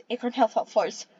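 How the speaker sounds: plain reading voice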